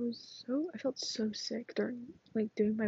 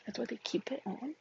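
ordinary talk, nothing marked

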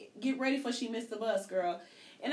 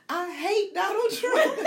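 slow, much higher and louder, cracking up; mocking kid, laughing; 10 semitones higher, 7 dB louder, much slower than usual. Mother retelling her daughter's outburst, laughing. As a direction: quote the kid's outburst through your laughter.

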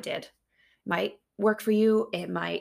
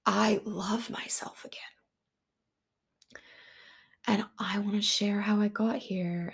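whisper. Real, not acted. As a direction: breathe the words like a secret. Intimate whispered podcast opening.

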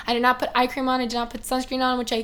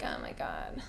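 whisper; 14 dB quieter, slower than usual. Pretend. Awed reaction to perfect posts.

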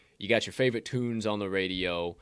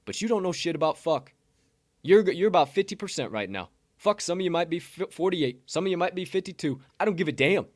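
higher, louder heated callout; heated rant; 8 semitones higher, 5 dB louder than usual. Real, not acted. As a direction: call them out, fired up. Provocative rant at the industry.

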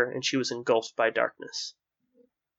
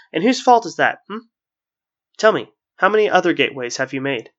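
louder, accusing questioning; angry challenge; 4 semitones higher, 7 dB louder than usual. A narrator's reading. Man confronting the wisps, angry challenge. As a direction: press them hard for an answer.

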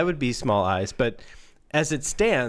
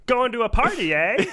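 higher, teasing shopkeeper voice; high sly voice; 10 semitones higher than usual. Acted. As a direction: sing it slyly and high. Redone character line.

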